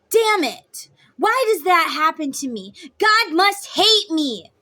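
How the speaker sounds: loud, high mock curse at bad luck; hammed-up exasperated yell; frustrated outburst. Frustrated person over flat tire.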